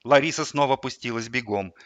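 plain reading voice

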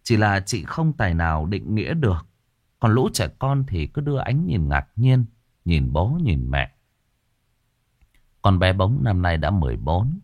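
plain reading voice

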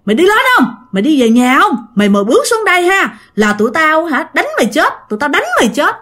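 a hint of a monster's threat, repeated and pressing; menacing threat. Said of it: "ổng mới chỉ con rằng á là".

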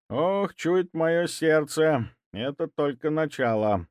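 put-on slow, high worried old man; grieving old man. A narrator's reading. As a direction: sigh it out, heartbroken grandfather. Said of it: "сокрушённо проговорил он". (сокрушённо, по-стариковски)

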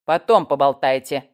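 loud, gruff interruption; barked order. A narrator's reading. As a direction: cut in like an irritated sergeant. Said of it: "рявкнул красноглазый сержант".

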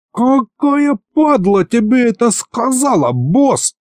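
high-pitched outraged roar, strained. A narrator's reading. Accused demon rounds on his boss, roaring. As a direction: roar in outrage without much added volume. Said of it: "взревел Аристофан".

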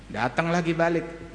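ordinary talk, nothing marked

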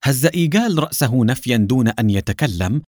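ordinary delivery, nothing marked

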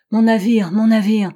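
loud, anguished demand; distraught voice. A narrator's reading. Captain to old Johnson about his ship, distraught cry. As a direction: cry it out, shaken and desperate. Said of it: "demanda-t-il d'une voix altérée".

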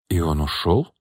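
understated astonished question, rising; low-key disbelief, lifting at the end; amazed. A narrator's reading. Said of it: "изумлённо спросила Анна".

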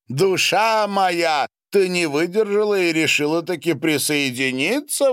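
high, loud exclamation to his wife; exclaiming. A narrator's reading. Savva greets his wife in the bathhouse, exclaiming. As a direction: call out heartily, pitch well up. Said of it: "воскликнул Савва".

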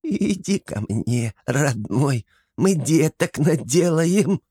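high, slow, cooing hag voice; baby-talk it like a creepy swamp witch; lisping kikimora coo. Acted. Антон as kikimora coos at Sher, high lisping croon. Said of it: "просюсюкал Антон".

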